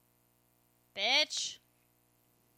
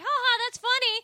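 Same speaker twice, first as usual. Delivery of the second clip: sarcastic mock laugh; 10 semitones higher, 5 dB louder than usual. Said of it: "The other person's not like".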